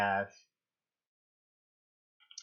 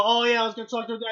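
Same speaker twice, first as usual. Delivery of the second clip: fast, higher breezy throwaway; mock casual chatter; 8 semitones higher, 5 dB louder, much faster than usual. Friend mocking sisters' casual reveal, fast breezy. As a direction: rattle it off carelessly.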